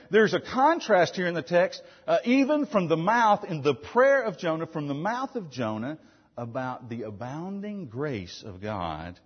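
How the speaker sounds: normal talking voice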